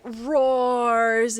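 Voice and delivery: ROARS stretched long and slow; drawn-out word. A narrator's reading. Game master describing the wounded creature, "roars" drawn out. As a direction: draw out the vowel in "roars". Narrator draws out the roar.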